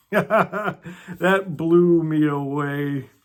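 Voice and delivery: slow, laughing through the words. Real, not acted. Vlogger amazed by the book's line, laughing. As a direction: let the laugh carry it.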